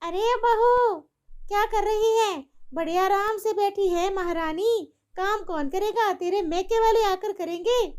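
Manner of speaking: high, nasty taunting mother-in-law; wicked mother-in-law. Acted. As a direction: sneer at the daughter-in-law.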